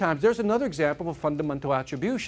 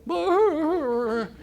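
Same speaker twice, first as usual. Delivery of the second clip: much higher rolling mock growl; dog growl; 12 semitones higher, 3 dB louder than usual. Dog lying on a tack, growling, high rolling brr. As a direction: let the hound whine out a rolling brr. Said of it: "the dog going what".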